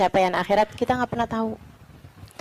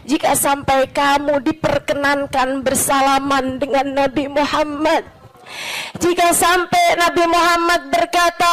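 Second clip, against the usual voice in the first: normal talking voice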